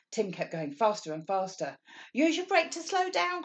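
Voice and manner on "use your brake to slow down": much higher, louder urgent call; crying out. Acted. Mummy calls after speeding Tim, high urgent cry. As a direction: call it out in alarm. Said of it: "cried Mummy".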